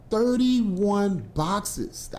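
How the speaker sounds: slow emphatic reveal; dragged out. Real, not acted. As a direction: stretch each word to stress the number. Stressing a shocking number.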